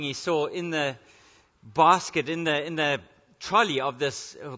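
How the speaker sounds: ordinary delivery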